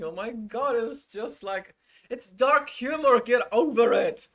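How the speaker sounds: loud, high, exasperated shout; exasperated outburst. Real, not acted. Streamer yelling at Amber's side, loud and exasperated. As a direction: throw up your hands and yell it.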